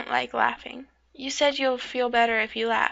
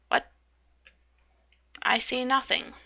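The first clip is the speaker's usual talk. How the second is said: ordinary talk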